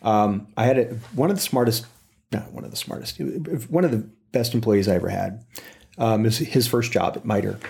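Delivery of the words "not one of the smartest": breathy whisper, dropped right down; a hushed correction to the listener; whispered aside. Real, not acted. Muttering a self-correction in a whisper.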